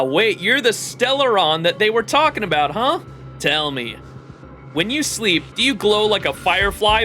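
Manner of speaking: normal talking voice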